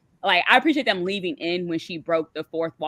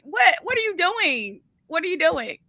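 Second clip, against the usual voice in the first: pitched up in disbelief; exasperated disbelief; 11 semitones higher than usual. Retold or imitated. Caller retells yelling at the screen, high and exasperated. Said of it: "And I was just like".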